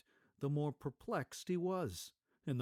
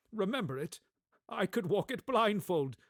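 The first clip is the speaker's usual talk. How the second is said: put-on eager old man; 7 semitones higher, 7 dB louder than usual. Scrooge eager.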